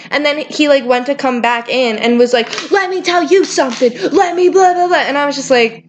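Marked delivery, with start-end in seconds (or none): 2.7-5.0 angry customer. Retold or imitated. Angry customer comes back in.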